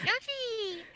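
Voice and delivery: very high cartoon creature cry; Yoshi. Co-host imitates a game character's call, high-pitched squeal. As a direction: squeal it out like a little game mascot. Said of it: "you hear, like".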